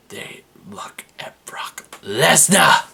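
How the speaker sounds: slow eerie whisper; slow hushed horror-movie whisper; spooky whisper. Acted. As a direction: whisper it like a ghost story. Spooky Wyatts stalking Lesnar.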